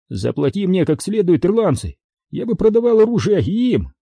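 high-pitched character boast; quartermaster's voice. A narrator's reading. Интендант о своей жадности. (голос интенданта, высоко и напористо)

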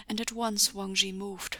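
ordinary delivery, nothing marked

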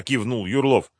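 usual speaking voice

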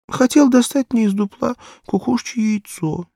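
put-on high, loud child's voice, anxious; a frightened little girl begging for help; little girl pleading. Barefoot girl asks every passer-by, high pleading child voice.

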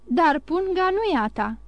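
father crying out at his daughter over the purse, alarmed. A narrator's reading. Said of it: "strigă Morel".

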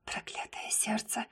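pained whisper. A narrator's reading. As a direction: breathe it out bitterly. Inner pain, whispered. (горький шёпот)

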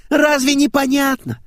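put-on high girlish exasperation; exasperated blonde. A narrator's reading. Blonde asks the car's name, exasperated. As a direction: start loud and high, then ease down.

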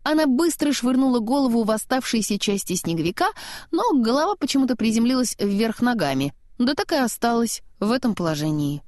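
ordinary delivery, nothing marked

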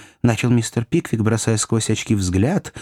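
plain reading voice

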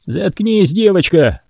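high snapping bark; barked order. A narrator's reading. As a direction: shut the girl up. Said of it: "рявкнул Роял".

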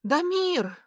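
high, loud call of the name; breathless shout. A narrator's reading. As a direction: shout after him, out of breath. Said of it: "крикнула я". (крик вдогонку)

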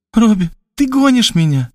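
tearful sister. A narrator's reading. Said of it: "В глазах её появились слёзы".

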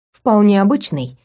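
plain reading voice

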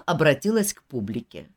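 ordinary delivery

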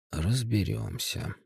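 soft, slow hushed word; whispered. A narrator's reading. Уваров тихо отвечает напарнику, soft whisper. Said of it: "прошептал Уваров".

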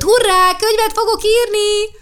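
put-on high, loud gleeful shout of a little girl; excited cheer. Sasha racing off delighted at writing a book, excited high cheer. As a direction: cheer it out as she runs off.

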